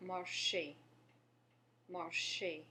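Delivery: "marcher" as mar-shay, two separate syllables; careful French pronunciation. Shown as a demonstration. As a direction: model "marcher" clearly for learners, ending in é. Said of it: "the next word we have".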